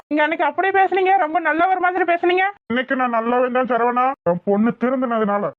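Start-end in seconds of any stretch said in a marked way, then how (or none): 0.1-2.4 high, sharp taunt at the uncle; bitter accusation. Acted. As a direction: throw his words back at him in anger. (கசப்பான குற்றச்சாட்டு)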